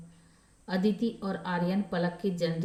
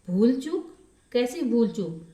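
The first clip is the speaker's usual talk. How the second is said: high, louder surprised echo; raised, rising question, then a falling follow-up; puzzled questioning; 5 semitones higher, 5 dB louder than usual. A narrator's reading. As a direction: query it in disbelief, voice lifting. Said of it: "शुचि ने प्रश्न किया".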